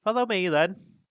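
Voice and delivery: high, loud gruff captain; captain of the guard. A narrator's reading. Captain leading the dwarves, high and loud. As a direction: give in grudgingly. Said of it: "said the captain".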